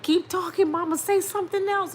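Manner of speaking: very high-pitched, overjoyed pleading; retold screaming. Daughter screaming and crying to her awakened mama, retold frantic and high. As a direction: squeal it as if re-living the frantic joy. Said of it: "I was just screaming".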